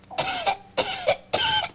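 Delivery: high, squeaky mock little-girl cough; hammed-up cough. Boss acting out a fire for the school board, mock cough. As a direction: cough like a trapped child, overplayed. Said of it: "She starts to cough".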